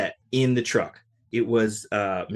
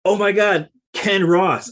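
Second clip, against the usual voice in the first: retold starstruck gasp, higher and louder; 9 semitones higher, 7 dB louder, slower than usual. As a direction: blurt it out like meeting your hero. Starstruck meeting drummer after show.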